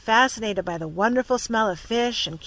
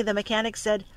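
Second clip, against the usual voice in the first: ordinary delivery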